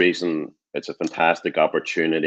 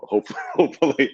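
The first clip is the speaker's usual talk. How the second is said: laughs through the word hopefully, pitched up; a real chuckle breaking into hopefully; laughing; 10 semitones higher, 4 dB louder than usual. Real, not acted. Laughs after accent joke.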